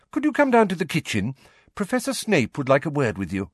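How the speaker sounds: high, kind motherly call; Mrs Weasley calling. A narrator's reading. Mrs Weasley calls Harry from the bedroom door, motherly. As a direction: sweetly call the boy down.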